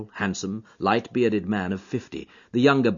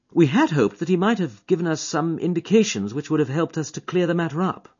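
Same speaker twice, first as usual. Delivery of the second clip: higher, louder eager detective; keen detective to his friend; Holmes voice; 9 semitones higher, 3 dB louder than usual. Acted. Holmes to Watson on the train, eager and keen.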